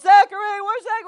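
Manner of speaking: high, loud search call; acted calling out. Family walking around SeaWorld searching, acted calling out. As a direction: holler for a lost child, re-enacted. Calling for lost child, retold.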